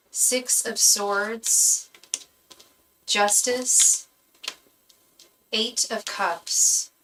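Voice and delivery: slow, deliberate card names; slow card reading. Acted. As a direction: lay out each card slowly. Announces tarot cards drawn.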